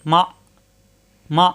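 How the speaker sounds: "মা" cut off in the throat; "মা" stopped short, then a pause; hard hamza stop. Shown as a demonstration. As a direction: say each "মা" with a firm glottal catch. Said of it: "হামজায় সাকিন আসলে শক্ত করে পড়তে হবে".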